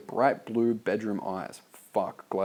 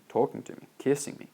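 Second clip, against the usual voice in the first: ordinary delivery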